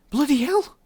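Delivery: very high, loud, stunned Irish; high-pitched Irish astonishment; heavy Irish accent. A narrator's reading. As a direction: echo it in stunned, heavily Irish tones. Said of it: "in her own heavily Irish accent".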